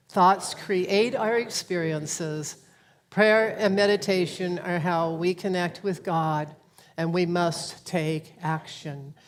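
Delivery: measured congregational recitation; recited creed. Acted. Leader reciting principles for all to say together, measured recitation. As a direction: recite it deliberately, pausing between statements.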